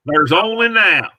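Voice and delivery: high, loud Southern accent. Shown as a demonstration. Showing the author a voice for his book, in a Southern accent. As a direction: belt it out with a Southern twang. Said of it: "I could do a Southern accent".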